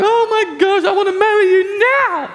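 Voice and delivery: high, loud, laughing gush of love; gushing excitement. Acted. Giddy dater gushing after a perfect evening, excited squeal. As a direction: squeal it out like you're swept off your feet. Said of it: "and it's like".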